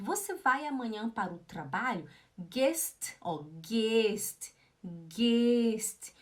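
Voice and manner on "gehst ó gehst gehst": GEHST with a stretched "ay", "gaaayst"; slow, drawn-out "gehst"; long E. Shown as a demonstration. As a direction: hold the vowel of gehst. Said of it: "O G E H é longo".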